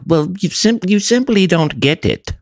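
high, far-off snob voice; snooty art snob. Retold or imitated. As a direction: look down on them from a great height. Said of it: "they're like".

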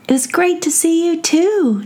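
put-on two children saying goodbye; child voices. Kids answer together.

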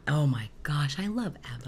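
whispered, breath-only fangirling; gushing whisper. Pretend. Co-host gushes over a favourite band, whispering. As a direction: gush it in a hushed whisper.

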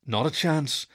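weary groan rising to a high, drawn-out recital; exasperated, pitch climbing as it goes. A narrator's reading. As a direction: groan out a rule you are sick of. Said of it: "I groaned".

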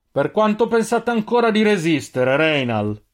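high, projected taunting call; calling from afar. A narrator's reading. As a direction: shout across to a cornered enemy. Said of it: "La voce di Deinar arrivava da poco lontano". (richiamo gridato da lontano)

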